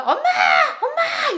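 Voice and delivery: put-on high, loud child's cry for mom; child calling mom. Ignored child calling his busy mother, high loud shout. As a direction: yell for mom like an ignored kid.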